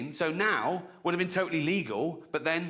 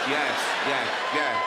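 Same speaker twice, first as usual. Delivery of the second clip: usual speaking voice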